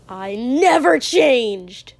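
loud, slow, furious retort; spat out angrily. A narrator's reading. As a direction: spit it out as a drawn-out yell. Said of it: "Katsuki spat".

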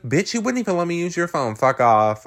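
high, rapid-fire pretend fury; mock livid. Recapper voices Tracy rejecting Martha, mock livid. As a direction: fire it off indignantly, hard on the last words. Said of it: "Tracy's livid".

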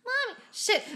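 put-on high child's voice breaking into a laugh; little kid voice. Voice actor fumbling a child's line, high and laughing. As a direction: squeak it like a kid, then laugh. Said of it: "three, four takes to be like".

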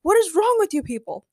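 high-pitched, scolding king; indignant shout. A narrator's reading. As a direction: berate the crowd in a high voice. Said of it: "shouted Ray".

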